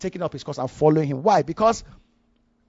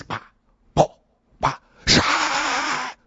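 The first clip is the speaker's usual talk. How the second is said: mock tennis sounds; 9 semitones higher, much slower than usual. Mimics tennis ball sounds.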